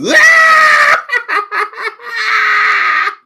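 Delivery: loud high cackling laugh; shrieking laugh. Real, not acted. Streamer cracking up at own joke, loud shrieking laugh. Big laugh after Joker remark.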